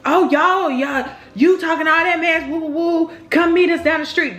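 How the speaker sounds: rowdy imitation. Cousins calling her out to fight, rowdy imitation. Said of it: "getting a little rowdy".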